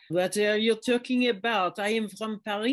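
light French accent, as if from Paris; a hint of a French accent. Shown as a demonstration. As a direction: play a Parisian who owns it. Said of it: "find your inner French person".

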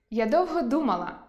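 ordinary delivery

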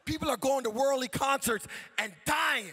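loud, driving sermon delivery; full-force preaching. Real, not acted. Preacher challenging the congregation to praise, full-force preaching. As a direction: preach it out to the crowd.

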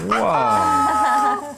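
high, loud delighted wow; excited wow. Real, not acted. Exclaiming to toddler over toy.

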